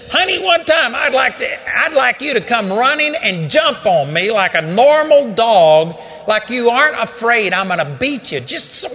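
loud, high angry yelling at the dog; fed-up owner yelling at his timid dog; scolding shout. Retold or imitated. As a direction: chew the dog out at full volume. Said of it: "reading the riot act of this dog".

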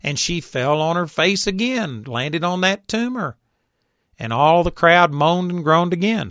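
ordinary talk

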